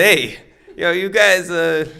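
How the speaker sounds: high, loud, badly faked Mexican accent; terrible Mexican accent. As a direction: put on a bad Mexican shop clerk accent. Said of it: "this is a terrible accent".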